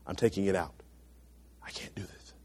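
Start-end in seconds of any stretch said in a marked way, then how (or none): 1.6-2.3 whispered surrender; unvoiced hushed aside. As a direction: breathe it out as a private admission. Acted inner voice giving up.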